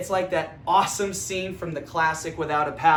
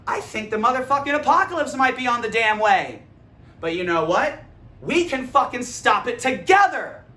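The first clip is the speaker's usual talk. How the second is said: fired-up rallying; 4 semitones higher, 7 dB louder than usual. Retold or imitated.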